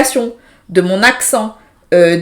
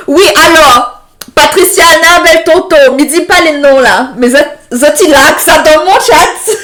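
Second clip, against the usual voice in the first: louder, higher, laughing at the chat; amused and louder; 5 semitones higher, 5 dB louder than usual. Real, not acted. Streamer answering viewers in the chat, amused and louder. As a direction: tease the viewers while laughing.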